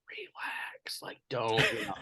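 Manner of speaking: whispered warning from the wife; wife's whisper. Retold or imitated. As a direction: hush him with a whisper. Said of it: "she was just like".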